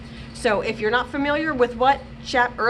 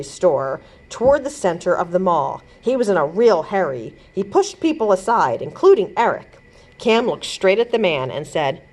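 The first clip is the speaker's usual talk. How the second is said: story narration; 4 semitones lower, 4 dB louder than usual. A narrator's reading. Narrator reading a chapter book to children, lively storytelling voice. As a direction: lively, clear read-aloud for kids.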